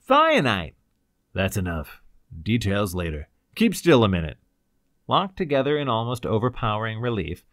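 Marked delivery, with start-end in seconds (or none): 0.1-0.8 high, loud exclamation; relieved gasp. A narrator's reading. Ryder tells his rescuer the drug, gasped relief. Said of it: "Ryder gasped in relief". 1.3-4.4 low, brisk authority; crisp command. A narrator's reading. Cloud quiets the excited prisoners, crisp command. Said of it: "as the crisp voice went on".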